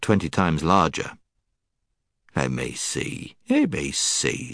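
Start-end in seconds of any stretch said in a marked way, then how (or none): 2.4-4.5 low, slow, thinking giant; a big giant mulling it over, slowly; musing giant. Acted.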